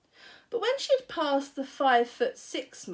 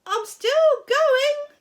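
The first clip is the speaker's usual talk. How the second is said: much higher, louder, slow screech; screechy old voice; 9 dB louder, slower than usual. A narrator's reading. Grandma calling from the attic. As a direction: screech from above like an old hag. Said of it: "old screechy voice".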